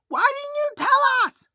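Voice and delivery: very high, loud, slow shrieked reproach; angry Mrs Brody. Retold or imitated. Mrs Brody scolding the men for hiding the shark, high loud outrage. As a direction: shriek it at them, betrayed and furious. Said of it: "Missus Brody was like".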